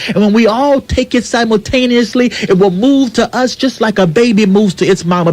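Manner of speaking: full-force sermon delivery; preaching. Acted. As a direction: preach it to the congregation, swelling. Impassioned speech on radio show.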